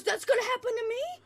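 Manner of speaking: very high, loud frightened voice; panicked soldier. Acted. Soldier hearing his fate from the doctor, high and panicked. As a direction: ask in terror if you're next. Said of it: "His friend goes".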